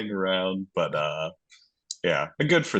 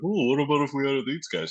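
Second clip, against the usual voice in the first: higher, fast, smug mock voice; a sneering parody of greedy managers; mocking Bruins management; 6 semitones higher, faster than usual. Mocking Boston's front office.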